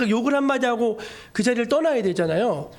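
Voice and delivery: ordinary talk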